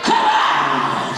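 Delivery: commanding exorcism. Retold or imitated. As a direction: order the spirit out with authority. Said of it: "We say".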